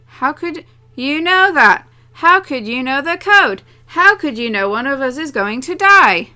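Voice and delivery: loud, high, slow accusing cries; anguished furious questioning; desperate shouting. Acted. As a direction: hurl each question at him. Character confronts, desperate shouts.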